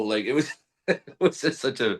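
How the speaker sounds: normal talking voice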